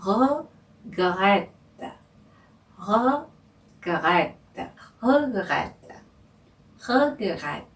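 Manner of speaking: "Regrette" repeated slowly with a throaty R. Shown as a demonstration. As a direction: drill "regrette" slowly, syllable by syllable. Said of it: "발음을 천천히 다시 해볼게요". (천천히 되풀이하는 "regrette")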